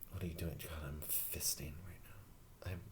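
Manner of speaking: hushed unvoiced whisper. Real, not acted. Whispered aside while rubbing hand.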